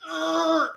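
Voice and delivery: high strained ugh of effort; mock a pained heave; strained grunt. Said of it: "like Tobey Maguire in Spider-Man Two".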